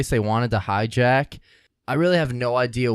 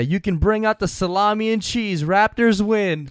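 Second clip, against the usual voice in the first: raised-pitch sports announcer call; Chuck Swirsky; 6 semitones higher than usual. Retold or imitated. Host quoting an announcer after Toronto wins, announcer impression. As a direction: imitate a victory call, stretching the ending. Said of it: "he would always say".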